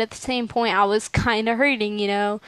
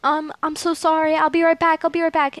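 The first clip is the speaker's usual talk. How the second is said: high-pitched, panicky retold rush of words; mock panic, voice pitched up; freaking out; 5 semitones higher than usual. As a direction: imitate a friend in a flustered panic. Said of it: "she starts freaking out".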